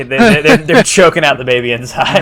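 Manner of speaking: loud, gleeful, half laughing; excited. Real, not acted. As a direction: blurt it out delighted. DM describing gory hit, laughing.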